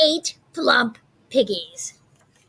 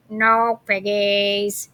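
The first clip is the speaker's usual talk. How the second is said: plain reading voice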